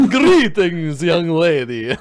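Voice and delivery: loud, high hammy vampire. Acted. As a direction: boom out a theatrical vampire greeting. GM hams up a vampire greeting.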